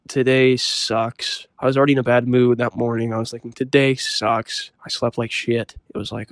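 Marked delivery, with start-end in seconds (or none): 0.1-1.5 slow gloomy groan. Retold or imitated. Gloomy thought about the day. 3.5-4.7 a drawn-out morning moan; slow sulky drone; slow gloomy groan. Retold or imitated. Repeating the gloomy thought.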